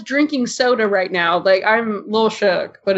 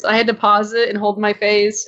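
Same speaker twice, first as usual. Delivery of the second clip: normal talking voice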